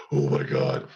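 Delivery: low breathless whisper of horror; low unvoiced oh my God; horrified whisper. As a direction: breathe it out in shock. Patient sees his finger.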